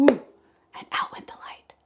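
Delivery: breathy unvoiced whisper. Shown as a demonstration. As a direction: whisper as the light goes out. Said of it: "like this".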